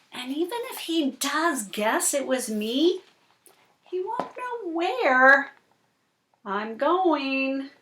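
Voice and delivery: slow, dramatic picture-book reading; reading to children. Acted. Reading the fish story to Cooper, slow and dramatic. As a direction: draw the little listener into the story.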